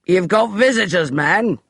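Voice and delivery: lightly voiced rough coachman; coachman calling. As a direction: call it out to the house.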